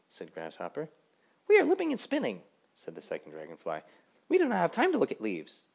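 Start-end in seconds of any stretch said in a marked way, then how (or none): 1.5-2.4 loud, high, boastful dragonflies; brash dragonfly voice. Acted. 4.3-5.7 loud, high, boastful dragonflies; brash dragonfly voice. Acted.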